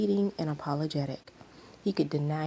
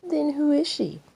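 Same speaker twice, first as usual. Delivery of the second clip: higher and louder, pointed demand; challenging; 10 semitones higher, 6 dB louder than usual. Acted. Aino challenging Yashiro, high and loud. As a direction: corner him with the question.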